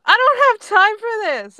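loud, high-pitched exasperation; angry outburst. A narrator's reading. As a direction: snap in impatience at a stubborn foe. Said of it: "Grape growls again and says".

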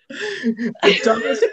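high cackle, words drawn out; laughing hard. Real, not acted. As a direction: laugh through it helplessly. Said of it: "laughing at own story".

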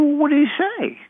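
put-on very high, eager kid's question; eager child. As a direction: ask it like a kid dying to know. Eager child self, retold.

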